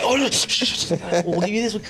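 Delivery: high coaxing hush; calm the little cousin with a bribe; mock sweet-talking; soothing hush. Kids hushing a crying cousin.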